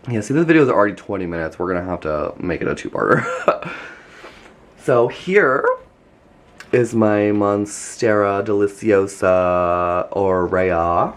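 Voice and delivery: normal talking voice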